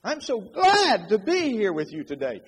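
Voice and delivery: high, loud, delighted delivery; big glad welcome with lots of lift; enthusiastic greeting. Shown as a demonstration. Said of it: "exact same words, two different messages".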